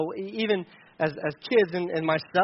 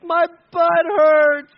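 high, loud, very slow tearful wail; crying child; 11 semitones higher, 9 dB louder, much slower than usual. Retold or imitated. Son on the bike tells dad his butt hurts, crying loudly. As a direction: wail it like a sore little boy who keeps pedaling.

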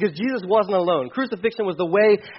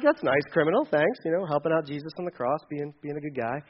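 softer, dry mock thanks; sarcastic aside; 4 semitones lower, 7 dB quieter than usual. Preacher mocking the thief's kindness, softly sarcastic. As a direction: drop it low and wry, a sarcastic thank-you. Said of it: "And I'm like".

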